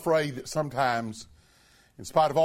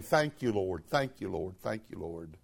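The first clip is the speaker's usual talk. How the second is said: fading softer and lower; soft prayer; 9 semitones lower, 7 dB quieter than usual. Pretend. Believer thanking the Lord, fading to a soft, low prayer. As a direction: whisper of thanks to God.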